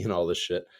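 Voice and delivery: ordinary delivery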